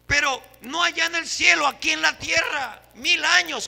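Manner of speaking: high, loud shout to the church; fervent preaching shout. Real, not acted. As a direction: proclaim it with full force. Preacher exclaiming to congregation.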